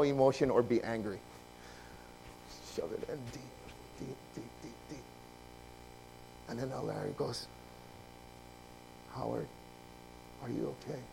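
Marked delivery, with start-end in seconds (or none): 2.8-5.0 low, fading almost to nothing; suppressing emotion. Acted. Mimes pushing emotion down. 9.2-11.0 low, very soft check-in; gentle concern. Retold or imitated. Elder checks on him.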